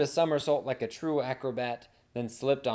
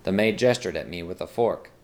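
ordinary delivery

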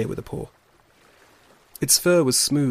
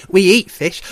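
high, very loud otter voice; boisterous otter; 7 semitones higher, 11 dB louder than usual. Acted. Shoreback explains what otters eat, loud and boisterous. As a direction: announce it proudly at full volume.

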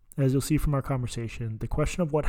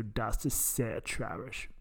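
bad German accent; 8 dB quieter, much slower than usual. Shown as a demonstration. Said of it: "practicing my very bad German accent".

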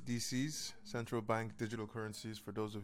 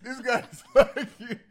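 loud, slow, laughing; 12 semitones higher, 16 dB louder than usual. Real, not acted. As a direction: drag it out through laughter. Host repeats joke, laughing.

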